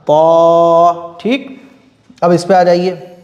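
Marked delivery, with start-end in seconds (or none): 0.1-1.2 "पा" as a heavy emphatic T with long rounded vowel; thick-sound demo. Shown as a demonstration. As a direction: hold "पा" full and level. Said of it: "मोटा". ("पा" को मोटा करके खींचें)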